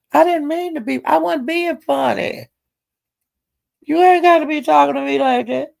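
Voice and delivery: high, whiny mock protest; squeaky put-upon voice insisting he meant no harm; imitating Jay Will. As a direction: pitch it way up, offended and defensive. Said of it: "Jay Will said".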